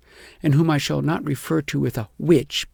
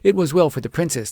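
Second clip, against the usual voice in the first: ordinary delivery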